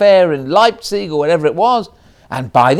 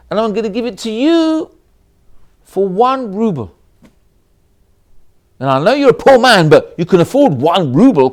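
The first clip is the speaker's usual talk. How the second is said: mocking offer; 4 semitones higher, 5 dB louder than usual. Rich man mocks poor man.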